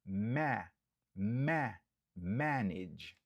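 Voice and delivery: MA- broken off twice, then manage with MA stressed; stress on first syllable. Shown as a demonstration. Stressing the first syllable for Creole learners. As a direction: split off MA before manage. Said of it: "Nou aksantye sou premye".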